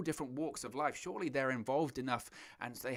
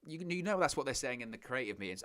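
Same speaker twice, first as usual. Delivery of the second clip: normal talking voice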